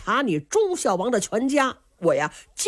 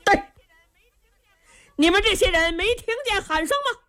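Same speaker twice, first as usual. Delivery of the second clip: general calling out from horseback, raised high; loud shout; 8 semitones higher than usual. A narrator's reading. General on horseback shouts at the chess players, loud high call. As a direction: bellow it down the alley, high-pitched. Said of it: "宇文成都在马上高声喊嚷".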